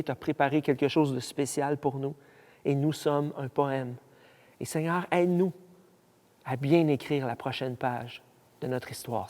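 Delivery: soft, low prayer voice. Real, not acted. Pastor praying aloud with listeners to the Lord, hushed prayer.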